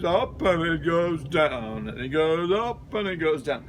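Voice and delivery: slow rise-and-fall, lightly sung; a gentle demo of an up-and-down accent; undulating accent. Shown as a demonstration. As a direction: roll the tune up and down slowly. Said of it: "he's an undulating accent".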